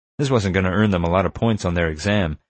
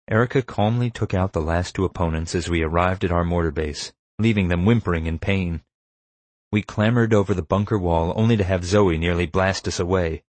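usual speaking voice

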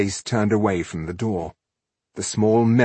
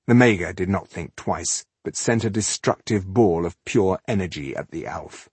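plain reading voice

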